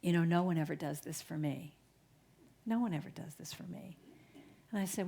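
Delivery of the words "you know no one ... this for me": soft, lowered confession from a touched stranger; softened. Retold or imitated. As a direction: let the guard down and say it quietly. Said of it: "her face is totally softened".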